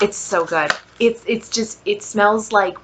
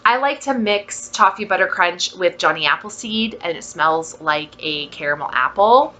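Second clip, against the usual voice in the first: ordinary delivery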